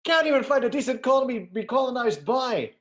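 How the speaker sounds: a laughing, over-the-top mock Scottish rant; bad Scottish impression, breaking into laughter; bad Scottish accent. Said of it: "I am not a good accent man".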